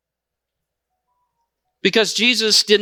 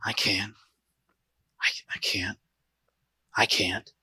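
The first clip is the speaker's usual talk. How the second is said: whispered, far lower and softer, giving up; defeated murmur; 13 semitones lower, 12 dB quieter than usual. Retold or imitated. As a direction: breathe it out like someone who has quit. Defeated people muttering.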